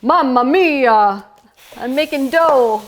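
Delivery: hammed-up Italian chef exclamation; slow, drawn-out Mamma mia; jolly Italian pizza chef. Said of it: "And I feel like a".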